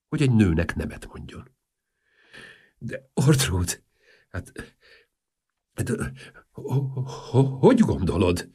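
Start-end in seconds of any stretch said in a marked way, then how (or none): 2.8-8.5 halting, stuttered protest with broken-off syllables; stammering. A narrator's reading. King stammering a protest to the princess, flustered stutter. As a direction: stumble over the first words, with pauses between attempts.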